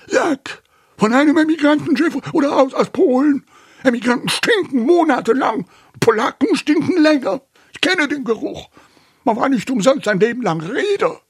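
put-on high, loud, racing old man's tirade; furious rant. A narrator's reading. Uncle Delbert rants about emigrants, furious. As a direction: rage on at speed, pitched right up. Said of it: "rief Onkel Delbert wütend und zitterte".

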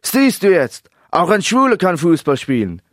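loud, high, uncontrolled outburst; defiant high-pitched yell; shouting into microphone. A narrator's reading. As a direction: blurt it out at full shout. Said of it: "schrie ins Mikrofon".

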